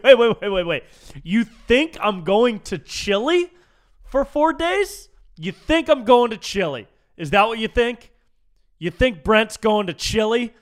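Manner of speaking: loud, high-pitched disbelief; incredulous. Retold or imitated. Disbelief at being sent to Chile.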